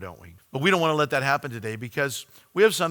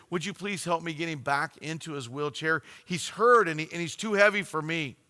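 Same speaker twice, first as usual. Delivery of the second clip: ordinary talk, nothing marked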